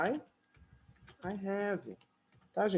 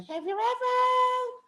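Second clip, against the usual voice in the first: very high, slow, stretched bellow; mock drunken singalong shout; drunk crowd yelling; 5 dB louder, much slower than usual. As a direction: belt it like a tipsy late-night crowd. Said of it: "Eles gritam".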